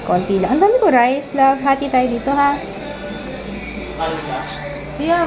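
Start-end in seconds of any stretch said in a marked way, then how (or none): none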